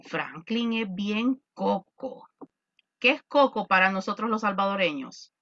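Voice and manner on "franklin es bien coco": slow, drawn-out slang phrase; slow slang example. Shown as a demonstration. Teacher slowly giving a Salvadoran slang example. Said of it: "cuando yo digo en español".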